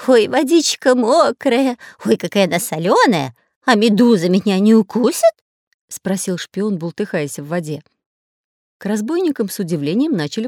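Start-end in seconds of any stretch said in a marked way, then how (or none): 0.1-5.3 whiny complaining. Acted. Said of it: "начал жаловаться".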